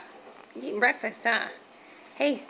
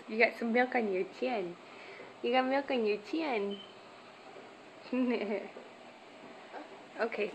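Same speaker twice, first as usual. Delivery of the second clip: usual speaking voice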